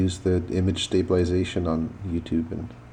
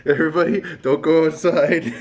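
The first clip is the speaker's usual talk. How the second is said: laughing; 7 semitones higher than usual. Real, not acted. Laughing warning.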